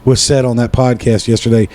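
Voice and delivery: ordinary talk, nothing marked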